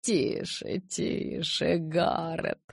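soft, calm hushing; patient soothing. A narrator's reading. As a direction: settle him down patiently. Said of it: "терпеливо успокоил Ара".

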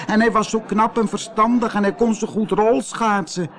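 high, eager exclamation; calling out. Acted. Koosje chimes in with the mourners, high call. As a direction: call it out high, piling on the praise. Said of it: "riep Koosje".

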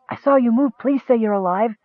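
high, trembling plea on the edge of tears; a frightened kender close to crying; voice almost breaking. A narrator's reading. Kender pleading over his wounded friend, voice breaking. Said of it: "his voice almost breaking".

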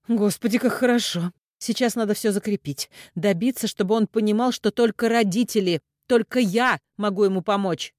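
relieved. A narrator's reading. Mother believes her plan worked, relieved and excited. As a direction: relief rushing into keen plans. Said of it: "с облегчением выдохнула".

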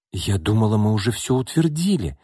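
ordinary delivery, nothing marked

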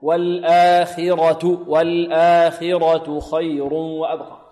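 very slow recitation, full ra in والآخرة; heavy ra. Shown as a demonstration. Teacher models the verse, slow with والآخرة's ra heavy. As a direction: recite slowly, light khi and heavy ra in والآخرة. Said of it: "ونفخم الراء".

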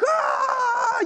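high delighted shout; gleeful yelling; retold joyful yell. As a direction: whoop like a kid in snow. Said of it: "yelling 'cause it's just so magical".

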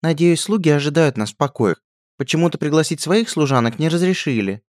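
high, prim complaining princess; a spoiled royal expecting service; haughty princess. A narrator's reading. Princess complaining to the lackey, high prim voice.

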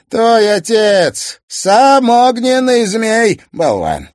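high, loud gloating villain; theatrical villain. Acted. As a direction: relish every cruel word. Host voiced as villain.

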